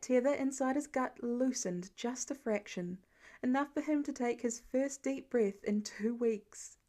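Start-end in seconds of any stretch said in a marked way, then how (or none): none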